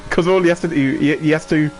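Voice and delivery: ordinary talk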